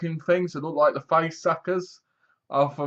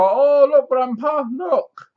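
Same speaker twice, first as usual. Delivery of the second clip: much higher, louder, gushing; excited granddaughter; 13 semitones higher, 7 dB louder, slower than usual. Retold or imitated. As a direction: squeal it like an eager girl. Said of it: "And she's like".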